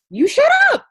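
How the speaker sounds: high, loud squealed disbelief; shocked squeal. Retold or imitated. To the girl learning her cause, shocked squeal. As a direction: shriek it in delighted shock. Astonished at same campaign.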